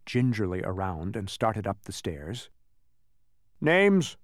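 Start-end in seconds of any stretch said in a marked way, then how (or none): 3.6-4.2 brisk, louder official bark; called out briskly. Acted. The census taker demanding names from the startled bug, brisk. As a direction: snap it out like a clerk. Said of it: "called out briskly".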